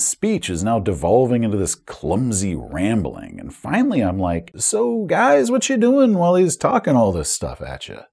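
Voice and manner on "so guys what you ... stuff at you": acted high-voiced query; puzzled game master. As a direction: call it out high, as if prompting a silent group. Acted game master nudging silent players.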